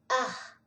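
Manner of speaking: "Ar" with a guttural r from the back of the throat; the local throaty "Ar"; "Ar" com R gutural. Shown as a demonstration. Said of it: "alguma coisa com a garganta".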